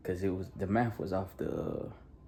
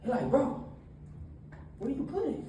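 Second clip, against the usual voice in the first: energetic church talk; preaching; 7 semitones higher than usual. Acted. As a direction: preach it to the young crowd with energy. Youth sermon with retold story.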